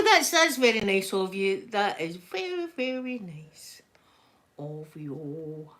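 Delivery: normal talking voice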